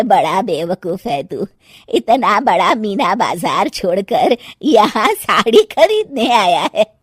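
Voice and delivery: ordinary delivery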